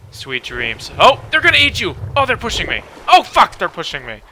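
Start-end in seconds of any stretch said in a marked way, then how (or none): 1.0-2.8 high, loud startled panic; panicked yell. Real, not acted. Watching sharks circle, a high panicked yell. 3.1-4.2 panicked yelp. Real, not acted. Sharks pushing him.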